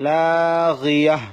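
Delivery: slow, drawn-out melodic Quran chant; slow chanted Quranic Arabic for a tajweed class; Quran recitation. Shown as a demonstration. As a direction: recite it slowly with tajweed and stop on the last word. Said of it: "আর একটা উদাহরণ দিচ্ছি".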